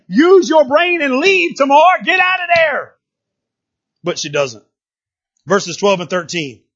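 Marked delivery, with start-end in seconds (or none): 0.1-2.9 high, loud, emphatic order to escape; forceful preacher's shout, voicing a command; urgent shouted warning. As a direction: yell it like warning someone in danger. Voices God urging Tamar to flee.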